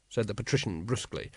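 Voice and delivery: plain reading voice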